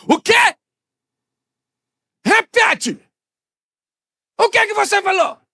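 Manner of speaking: loud, high-pitched snapping at a spouse; angry shouted demand. Acted. Husband who didn't understand his wife at home, angry shout. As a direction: yell it like a fight at home.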